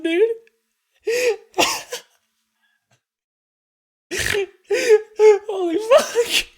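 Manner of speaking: high, breathless laughter through words; laughing hard. Real, not acted. Streamer overwhelmed by the game, laughing. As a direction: crack up, barely getting words out.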